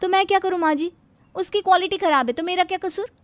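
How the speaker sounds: high, mildly indignant comeback; defiant retort. Acted. Bride talking back to mother-in-law, mildly indignant.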